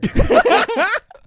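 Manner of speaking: bursting into loud laughter; high cackling laugh. Real, not acted.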